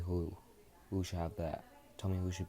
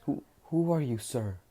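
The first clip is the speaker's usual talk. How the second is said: timid startled question; 6 semitones higher, 5 dB louder than usual. Acted. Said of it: "Midoriya says".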